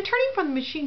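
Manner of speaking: ordinary talk, nothing marked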